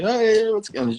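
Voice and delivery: high-pitched, friendly hail; excited greeting. Retold or imitated. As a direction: call out a cheery hello, pitched well up. Excited greeting retold among friends.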